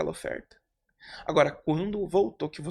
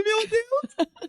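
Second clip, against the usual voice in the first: mock dismay. Dread at seeing the rich woman.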